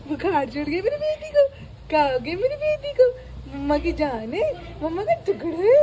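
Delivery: high, tearful cry over the sick pet. Real, not acted. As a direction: let it break into real tears. Worried owner over feverish pet. (रोते हुए, ऊँची टूटती आवाज़ में)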